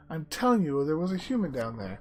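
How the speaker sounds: insistent goblin. A narrator's reading. Goblin telling the others of an intruder, insistent. Acted goblin quote in the story.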